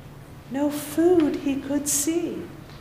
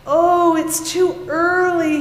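sleepy sigh; 5 semitones higher, 5 dB louder, slower than usual. Acted. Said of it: "he said with a sigh".

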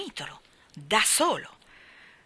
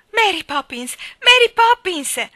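high, loud cries building higher; joyful shouting; 12 semitones higher, 14 dB louder than usual. A narrator's reading. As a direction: call out the name, the second time drawn out. (grida acute, in crescendo)

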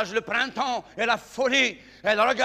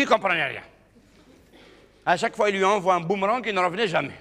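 ordinary talk

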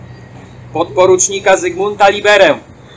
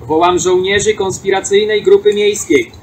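usual speaking voice

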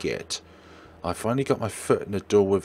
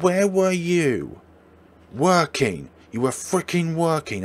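slow, high and loud accusation; angry yell; 8 semitones higher, 5 dB louder than usual. Acted. Girlfriend accusing boyfriend of always working, angry. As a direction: hurl it at him.

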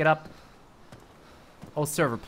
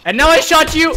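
much higher, louder cry of dismay; horrified yell; 13 semitones higher, 13 dB louder than usual. Real, not acted. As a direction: yell it as you realise your mistake. Accidentally shoots his teammate.

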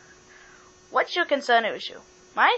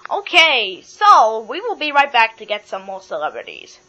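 ordinary delivery

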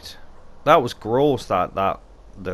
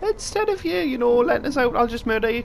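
mock sweet menace; 13 semitones higher than usual. Mock threatening a game character.

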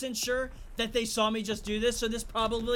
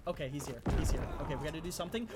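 normal talking voice

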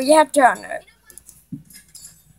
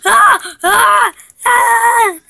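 repeated loud high screams; panicked screams; 8 semitones higher, 5 dB louder, much slower than usual. Pretend. Player swarmed by game monsters, panicked screams. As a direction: scream in play terror, again and again.

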